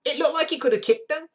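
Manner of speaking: very high, loud put-on voice; hammed-up high-pitched witness quote; eyewitness voice. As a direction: squeal it as the eyewitness. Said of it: "One eyewitness said".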